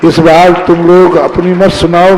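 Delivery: ordinary talk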